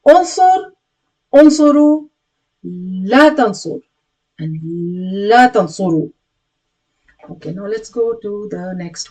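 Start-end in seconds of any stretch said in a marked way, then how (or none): 0.1-2.1 higher, louder drill call; command drill. Shown as a demonstration. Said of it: "again, once more". 2.6-6.1 slow, drawn-out la tansur; slow negation drill. Shown as a demonstration. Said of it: "once more".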